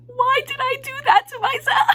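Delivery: high, loud mock-panicked wail; freaking out. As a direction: wail it in hammed-up self-reproach. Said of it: "I'm still freaking out".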